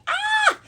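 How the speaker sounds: loud, very high delighted squeal. Real, not acted. Vlogger squealing over her beloved bag, very high.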